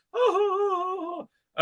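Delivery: high wordless oohs; hammed-up high, wavering oohs; mock falsetto wail. Acts wailing on the voyage.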